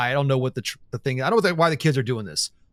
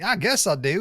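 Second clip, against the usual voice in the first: teasing Southern imitation, higher; 4 semitones higher than usual. Host teasing co-host about the answer, mock Southern. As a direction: mimic a Southern lilt. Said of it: "You really went Southern on that".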